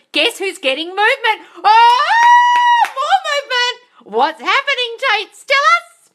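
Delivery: thrilled shout; excited cheer. Real, not acted. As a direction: hype up the viewer. Excited about viewer's movement.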